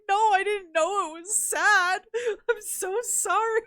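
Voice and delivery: high, loud, drawn-out wailed apology; hammed-up weepy protest, slow and pleading; mock tearful. Apologizing to partner for the sad movie, mock tearful. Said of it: "tearing up and crying".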